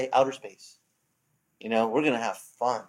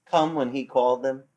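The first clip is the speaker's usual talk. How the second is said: ordinary talk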